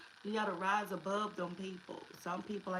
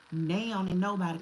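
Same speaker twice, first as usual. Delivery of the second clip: emphatic; 9 dB louder than usual. Real, not acted. Preaching emphasis on standards.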